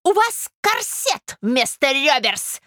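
high, slow, spiteful jab; affronted lady, taunting. A narrator's reading. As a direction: add an insult with relish. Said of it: "прибавила Наталья Дмитриевна". (язвительная дама, высоко и протяжно)

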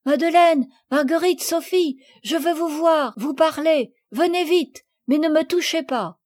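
calling out. A narrator's reading. Camille calls her friends in the garden, calling out. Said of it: "en criant".

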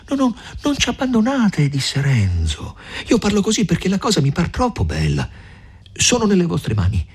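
don't let her walk away; Renzo, pleading. A narrator's reading.

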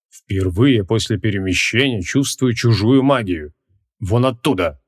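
slow, hoarse wizard voice. A narrator's reading. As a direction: draw it out hoarsely, sensing something. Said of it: "хрипло сообщил маг".

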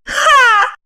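short high mocking laugh; scornful "ha". Wife laughs at husband, one high scornful ha. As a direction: spit out a laugh. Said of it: "рассмеялась Загогулина".

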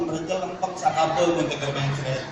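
ordinary delivery

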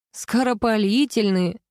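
slow, mocking echo of скоропалительные; laughing. A narrator's reading. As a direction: repeat скоропалительные, cracking up. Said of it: "расхохотался Ярослав".

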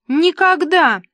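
high, loud, petulant; capricious refusal. A narrator's reading. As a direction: cut them off like a spoilt star. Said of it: "капризно отрезал Карлуша". (капризно, отрезая)

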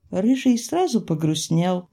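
ordinary delivery, nothing marked

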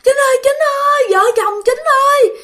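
frantic ghostly calling of Chính, hammed up; old man crying out in his sleep. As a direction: howl for your son while waving a knife in a dream. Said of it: "Vừa quơ mà vừa kêu".

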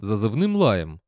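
usual speaking voice

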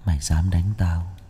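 low, quiet voice as if from the underworld; a possessed boy threatening low; gloomy otherworldly voice. Acted. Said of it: "giọng âm u, như từ địa cõi vọng về".

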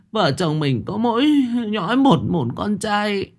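a mother sobbing for her only son. Acted. Mother sobs to her husband, fearing for her son. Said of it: "bà nức nở".

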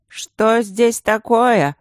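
put-on drunken slurred question; loud, slow drunken demand; town drunk. A narrator's reading. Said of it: "раздался пьяный голос Инландера".

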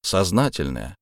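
usual speaking voice

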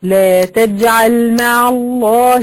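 slow chanted verse, held long vowels; Quran recitation. Shown as a demonstration. Teacher quoting a verse to students, recited slowly. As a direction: recite it in measured tajweed.

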